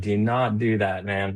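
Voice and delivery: ordinary talk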